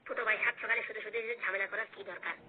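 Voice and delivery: put-on low, soft older man's plea; calm them down as the tired elder; weary elder brother.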